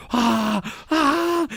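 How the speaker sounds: high-pitched imitated screams; mock scream. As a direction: imitate someone screaming on a ride. Imitates brother screaming on ride.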